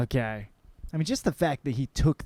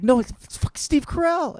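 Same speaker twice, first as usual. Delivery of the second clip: high, louder excited correction; excited insistence; 5 dB louder than usual. Retold or imitated. Girlfriend correcting her boyfriend, high and excited. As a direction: insist it's the real star.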